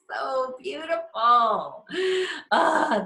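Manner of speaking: overwhelmed, letting the tears come; happy crying. Real, not acted. Overcome watching members' successes.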